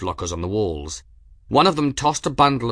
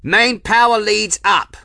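high, loud call-out; shouting; 12 semitones higher, 9 dB louder than usual. A narrator's reading. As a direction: shout across the engine room. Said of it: "someone shouted".

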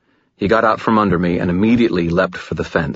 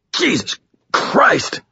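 high, drawn-out cry of pain; pained stammer; slower than usual. A narrator's reading. Pinned man protesting as his arm twists, pained. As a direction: yelp it slowly through the hurt. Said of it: "Thomas stammered, his voice pained".

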